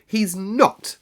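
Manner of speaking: high, loud indignant shout; almost shouting in outrage; fierce indignation. A narrator's reading. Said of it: "almost shouting up the wall in her fierce indignation".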